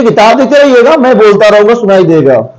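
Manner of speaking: usual speaking voice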